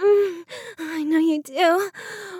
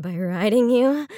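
ordinary talk, nothing marked

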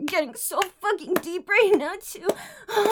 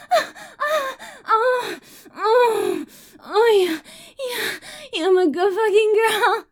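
higher, gasping cries of climax; 6 semitones higher than usual. Acted. Streamer performing her climax, crying out high. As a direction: let it peak and shake through the words.

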